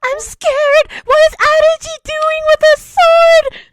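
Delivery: put-on high, rising to loud, frightened boy; hammed-up panicked child; scared kid voice. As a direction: play a terrified little boy spotting a sword. Voicing scared Gokotai.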